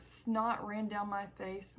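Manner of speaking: ordinary delivery, nothing marked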